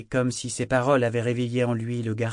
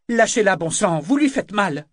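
loud, high urgent protest; alarmed demand; 9 semitones higher, 7 dB louder than usual. A narrator's reading. Sailor sees Kyle hurting Althea, protesting in alarm. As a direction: order him to let her go.

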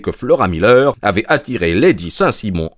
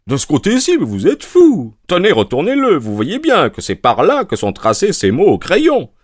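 exasperated; 8 semitones higher, 3 dB louder than usual. A narrator's reading. Lestrade argues with Holmes.

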